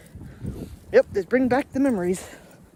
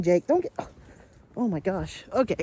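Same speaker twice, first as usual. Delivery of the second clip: normal talking voice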